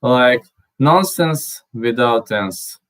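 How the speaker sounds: normal talking voice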